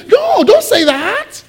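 very high, drawn-out pleading; Peter. Retold or imitated. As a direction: stretch it into a high dismayed protest. Imitates Peter protesting to Jesus.

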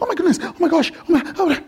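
high, tumbling exclamations; mock amazement. First-time movie viewer reacting, high mock amazement. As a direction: pile up high, astonished reactions.

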